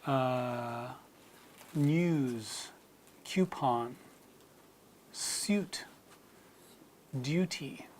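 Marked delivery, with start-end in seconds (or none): 1.7-7.9 news coupon suit duty with a y glide, nyooz kyoopon syoot dyooty; British yod. Shown as a demonstration. Teacher modelling the British yod in a word list. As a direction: slip a y after the first consonant in news, coupon, suit, duty. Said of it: "words in British like".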